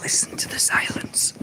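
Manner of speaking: hushed whisper to the silence. Real, not acted. Outside, hushing for the silence.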